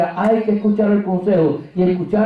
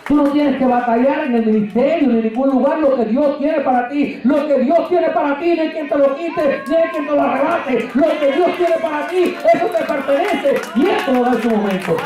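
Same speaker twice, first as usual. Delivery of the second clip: fiery preaching; 5 semitones higher than usual. Acted. Preacher exhorting the congregation, rising fervour. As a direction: build it like a pulpit climax.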